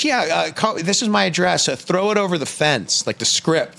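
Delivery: high, casual voice of a father giving directions; imitated dad. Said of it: "He goes".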